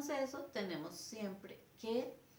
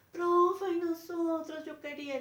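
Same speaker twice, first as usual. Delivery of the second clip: put-on higher, pleading children's voices; a hint of whiny pupils; kids' voices; 9 semitones higher, 7 dB louder than usual. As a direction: mimic frustrated pupils calling out. Children protesting to the teacher.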